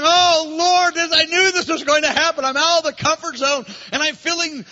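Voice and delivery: crying out to the Lord, near tears; bride's tearful outburst; anguished cry. Acted. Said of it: "And she's going".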